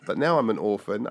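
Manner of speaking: normal talking voice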